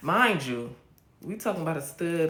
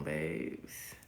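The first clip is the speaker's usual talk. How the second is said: ordinary delivery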